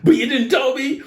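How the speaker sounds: high-pitched indignant complaint; retold protest. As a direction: imitate someone whining that they weren't told. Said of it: "he's there going".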